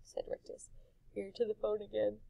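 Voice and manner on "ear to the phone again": plain reading voice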